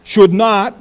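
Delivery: loud, firm emphasis on "should"; stress on SHOULD. Shown as a demonstration. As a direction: lean hard on "should", then "not". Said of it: "emphasize on the word should".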